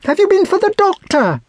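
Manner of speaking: put-on high, urgent girlish question; frantic high-voiced demand; anxious girl. A narrator's reading. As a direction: ask it pitched far up, in distress. Frantic girl questions the old servant.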